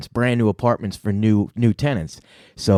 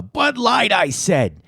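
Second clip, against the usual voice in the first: high opening, falling finish; ad catchphrase parody; 8 semitones higher, much slower than usual. Retold or imitated. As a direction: call the brand high like an insistent demand. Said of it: "it was, uh".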